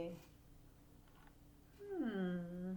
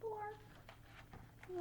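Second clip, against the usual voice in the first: soft lonely squawk; 11 semitones higher, 12 dB quieter than usual. Acted. Bird alone in woods.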